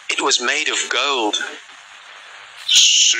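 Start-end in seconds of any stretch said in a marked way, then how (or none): 2.7-3.2 giant voice. Acted. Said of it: "said the giant".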